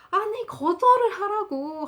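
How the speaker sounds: high, loud, drawn-out mock outburst. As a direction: imitate an exasperated friend losing their temper. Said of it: "성질 내더니". (성질 내듯 높고 크게 늘여서)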